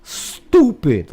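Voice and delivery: "Stupid" with a long, high oo; mocking drawn "Stupid"; stooopid. Shown as a demonstration. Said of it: "that's how they would, like, spell it".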